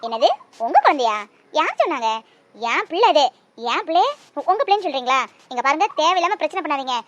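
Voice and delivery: loud, indignant rebuke; mother angrily telling the stranger off; angry mother. Acted. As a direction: defend her baby, loud and firm.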